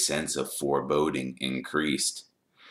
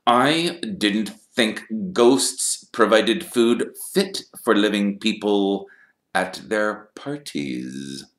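slow, higher, deliberate delivery sinking at the end; sardonic Snape; 6 semitones higher, 6 dB louder, slower than usual. A narrator's reading. Snape doubting the pupils ate with ghosts, slow and sardonic. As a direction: lay out the doubt word by word.

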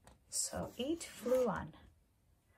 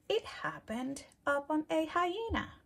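ordinary delivery

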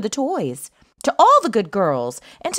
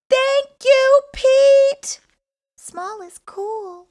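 much higher, louder elf cheer; 14 semitones higher, 6 dB louder, much slower than usual. A narrator's reading. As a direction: squeak out thanks like a tiny elf. Elves cheer Pete.

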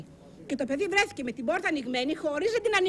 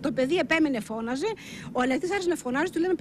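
usual speaking voice

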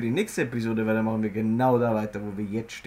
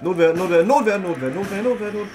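being chased; 7 semitones higher, 5 dB louder than usual. Real, not acted. Chanting while fleeing.

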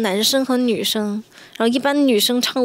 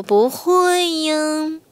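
cutesy drawn-out whine; 4 semitones higher, much slower than usual. Pretend. Playful drawn-out complaint.

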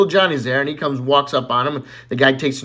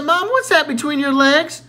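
put-on innocent child; 11 semitones higher, 3 dB louder than usual.

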